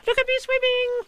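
very high, tiny squeaking voice; squeaky Roo. A narrator's reading. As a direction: squeak it like a proud baby kangaroo. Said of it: "squeaked Roo".

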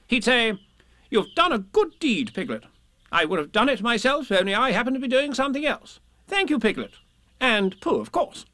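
high, emphatic, approving voice; confident Rabbit. Acted. Rabbit quoting Christopher Robin's imagined praise to Piglet, confident. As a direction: hand out praise with assurance.

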